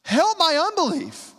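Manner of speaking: a desperate father crying to Jesus; cry from the soul. Shown as a demonstration. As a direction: cry it out from the depths. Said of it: "this cry from the soul".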